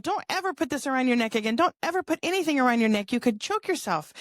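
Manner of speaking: imitating a mother's quick, high-pitched scolding; stern but concerned. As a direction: warn a child urgently, stern with worry. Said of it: "like, stern with her".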